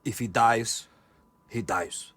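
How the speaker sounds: low, quiet Russian movie villain; cold Russian-accented menace, low and hushed; Drago. Shown as a demonstration. Showing co-host the Drago quote, low Russian accent. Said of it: "he goes like this, watch".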